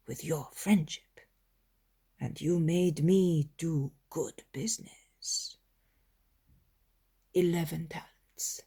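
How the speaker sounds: flattering whisper. Retold or imitated. Isaac flatters in secret.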